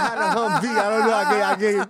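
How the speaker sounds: high, loud, words broken by laughter; laughing while talking. Real, not acted. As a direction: keep laughing through the line. Laughing after being caught.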